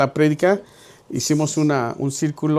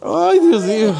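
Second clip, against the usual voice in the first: louder and higher, laughing it out; laughing exclamation; 7 semitones higher, 5 dB louder, slower than usual. Real, not acted. Pastor laughing that the size fits, loud. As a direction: laugh in disbelief.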